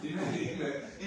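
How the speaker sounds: usual speaking voice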